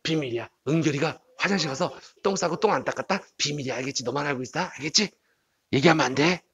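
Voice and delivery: very soft, low gossiping murmur; secretive hushed voice. Acted. Friend telling a classmate's toilet secret, in a hushed conspiratorial voice. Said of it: "자, 이 친구 뭐라고 그래".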